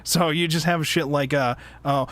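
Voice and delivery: usual speaking voice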